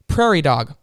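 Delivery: loud yell. Retold or imitated. Friend yelling an animal name in a card game, loud. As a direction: shout it across the table. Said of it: "I yelled at her animal really loud".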